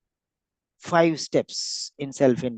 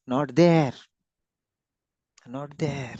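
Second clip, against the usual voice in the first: ordinary talk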